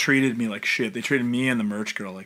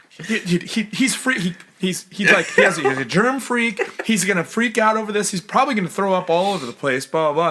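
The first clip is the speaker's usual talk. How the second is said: stammering, higher mock alarm; full-on actor mode; 7 semitones higher, 4 dB louder than usual. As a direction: fumble into a hammed-up explanation. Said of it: "full-on actor mode".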